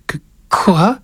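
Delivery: put-on high, stammering child; slightly quavering. A narrator's reading. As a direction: stammer it in a worried small boy's voice. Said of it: "la voix un peu vibrante".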